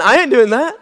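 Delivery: mock the congregation in a Southern accent. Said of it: "some of you are looking at me like right now saying".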